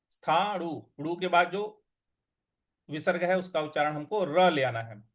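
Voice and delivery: ordinary talk, nothing marked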